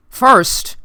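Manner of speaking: loud, high, forceful demand; insistent. A narrator's reading. Stranger overriding the officer's questions, loudly insistent. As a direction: cut in hard and insist. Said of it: "he insisted".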